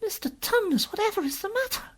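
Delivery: put-on very high, worried child's voice; concerned little girl. Lucy asking the faun what is wrong, high and concerned. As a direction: voice a puzzled girl, pitched right up.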